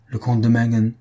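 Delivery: mildly puzzled echo of the name; understated astonishment; astonished. A narrator's reading. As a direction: repeat it as a surprised question. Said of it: "répéta Charlotte d'un air étonné".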